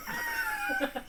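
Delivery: sudden squealing laugh; high shriek laugh. Real, not acted. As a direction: shriek of laughter that startles the room. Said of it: "Don't do that again".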